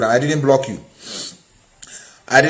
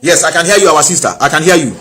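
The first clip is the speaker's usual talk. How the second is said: loud, raised confirmation; louder, higher answer down a bad line; calling out; 4 semitones higher, 6 dB louder than usual. Real, not acted. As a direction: make sure the caller hears you. Reassuring caller who cannot hear.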